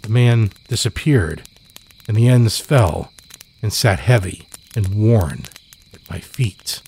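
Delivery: usual speaking voice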